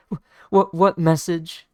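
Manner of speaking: loud, high stuttered question; nervous bandit blurting; frightened stammer. Retold or imitated. Bandit terrified of swordsman.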